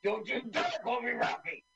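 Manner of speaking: high, put-on indignant voice; mock gruff Ben Grimm. Retelling the film, a high mock indignant retort. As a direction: snap back like an offended hero.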